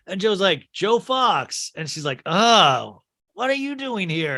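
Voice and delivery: normal talking voice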